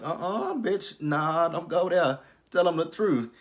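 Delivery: high, loud "uh-uh, don't go there"; sassy clapback. Retold or imitated. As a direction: shut it down with full attitude. She talks back to the character.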